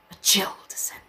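hushed, ominous whisper; voiceless, mock-eerie hush; spooky whisper. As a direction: whisper it for creepy effect. Spooky paraphrase of the passage.

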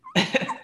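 short high-pitched laugh; tiny squeal of laughter. Real, not acted.

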